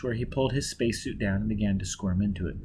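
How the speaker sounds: usual speaking voice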